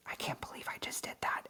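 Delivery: mock whispered self-scolding; whispered self-reproach. Scolding herself after a mistake, whispering. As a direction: whisper it in embarrassment at yourself. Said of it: "hardest things".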